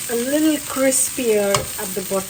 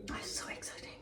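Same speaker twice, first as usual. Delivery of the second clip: whispering; 23 dB quieter, faster than usual. Real, not acted. Whispers before tasting the dish.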